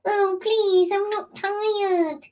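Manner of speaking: put-on high, slow childlike whine; whiny pleading. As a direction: beg like a tired kid. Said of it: "I'll be like".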